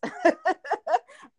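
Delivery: bright laugh, pitched high; short real laugh. Real, not acted. Laughing at Lena's joke.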